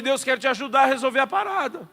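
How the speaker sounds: loud, high, zealous mock plea; eager calling out. Preacher re-enacts his offer to help God, eager shout. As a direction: call out with youthful zeal.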